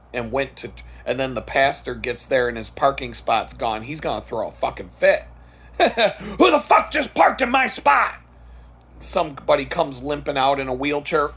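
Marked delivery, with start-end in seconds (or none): 5.8-8.2 high-pitched furious outburst; angry high yell. Pretend. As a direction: shriek at whoever took the spot. Pastor voiced throwing a fit.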